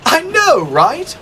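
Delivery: high, eager tank engine voice; bright chirpy engine character; Thomas voice. A narrator's reading.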